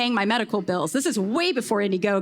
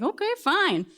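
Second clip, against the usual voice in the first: grudging agreement, retold; 8 semitones higher than usual. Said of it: "I was like".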